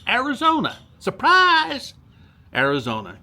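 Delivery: high mock-surprised cry of the town name; mock surprise. Viewer shout-out, hamming up the town name Surprise. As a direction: pun on the town, squeal it.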